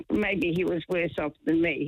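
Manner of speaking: usual speaking voice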